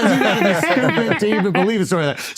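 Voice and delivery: fast, light throwaway denial; breezy rapid dismissal; quick brush-off. Retold or imitated. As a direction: toss it off quickly and casually. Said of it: "but he just says".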